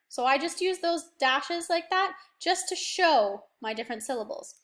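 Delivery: ordinary delivery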